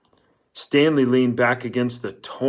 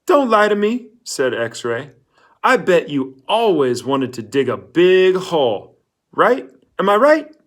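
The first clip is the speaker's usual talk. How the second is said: insistent; 6 semitones higher, 5 dB louder than usual. Acted. X-Ray pressing Stanley to agree.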